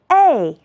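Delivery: fourth-tone "A", sharp fall; tone drill. Shown as a demonstration. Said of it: "和老师一起来读读".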